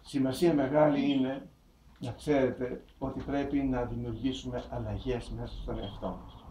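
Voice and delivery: ordinary delivery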